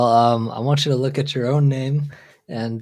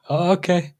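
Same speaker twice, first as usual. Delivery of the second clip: put-on pitched-up Vietnamese accent; 7 semitones higher than usual. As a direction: lightly imitate a Vietnamese accent. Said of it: "in his Vietnamese accent".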